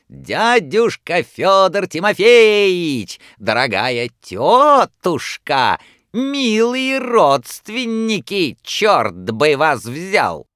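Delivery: high, slow, loud showman; booming circus clown. A narrator's reading. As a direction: ham it up for the audience. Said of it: "закричал хозяин". (громкий клоунский голос)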